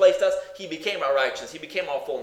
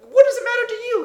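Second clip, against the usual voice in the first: excited burst; 7 dB louder than usual. Real, not acted. Preacher's rhetorical outburst.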